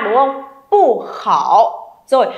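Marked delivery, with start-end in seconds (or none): 0.7-1.9 "Pu khảo": falling pu, low dipping khảo; slow model of "Pu khảo"; fourth then third tone. Shown as a demonstration. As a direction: drop "Pu", then dip slowly through "khảo". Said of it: "Khảo này là thanh ba".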